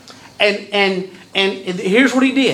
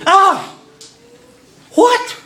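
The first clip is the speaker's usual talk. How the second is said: appalled disbelief; 5 semitones higher than usual. Retold or imitated. Paul answering the question about sin and grace, appalled. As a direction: sound scandalised by the very idea. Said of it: "closest English translation".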